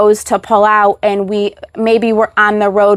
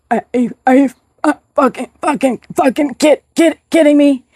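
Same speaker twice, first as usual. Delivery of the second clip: broken, stammering words, rising higher to the end; seizure-jerked stammer; 4 semitones higher than usual. Retold or imitated. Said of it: "Just like that".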